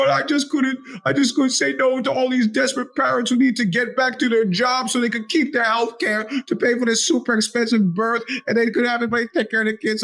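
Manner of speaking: high, put-on voice of the daycare woman, pleading; whiny excuse. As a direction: play the guilty nanny making excuses in a high voice. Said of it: "She goes".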